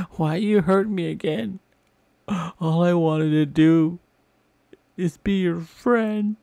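high, loud, slow hammed-up wail; hammed-up wail at the building; mock wail. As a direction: wail it out like a hurt kid. Wailing at building.

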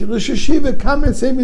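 high, loud begging cry; desperate plea. Retold or imitated. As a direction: shout it like a panicked student. Student begs to be saved.

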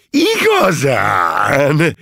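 high, loud, very drawn-out rapture; stretched ecstatic question; enraptured. A narrator's reading. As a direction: gush with delighted wonder. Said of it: "érdeklődött elragadtatással".

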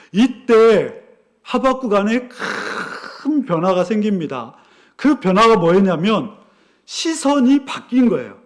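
ordinary talk

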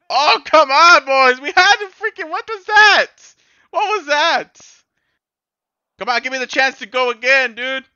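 loud, high, exasperated shout at the game; frustrated yelling. Real, not acted. Gamer yelling at his team after a missed chance, furious shout. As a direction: yell at your players for blowing it.

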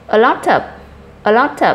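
a lot of run together, lot and of linked into one smooth unit; teacher links the words for students to copy, linked smoothly. Shown as a demonstration. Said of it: "các em nối âm sang đây nhé".

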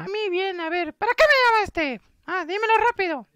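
put-on high falsetto girl's voice; cartoonish falsetto, pressing for an answer; impatient girl friend. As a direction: put on a squeaky, demanding girl voice.

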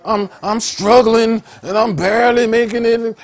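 high, loud mock-pitiful moan; hammed-up struggler's lament; pitiful complainer. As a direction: play up the pity, strained and high. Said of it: "supposed to be pitiful like them".

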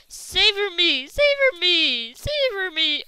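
high, loud, drawn-out cries; panicked plea. Real, not acted. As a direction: wail it out desperately. Crying out during zombie attack.

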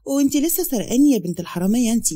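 plain reading voice

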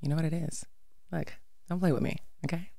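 lightly voiced, soft, short no-nonsense sentences; a hint of Gabrielle Union's cool sass; Gabrielle Union impression. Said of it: "Gabrielle Union impression".

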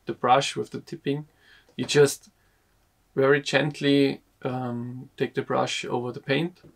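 ordinary talk, nothing marked

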